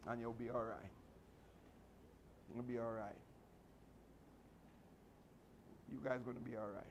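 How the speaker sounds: reassuring. Real, not acted. Reassuring students softly.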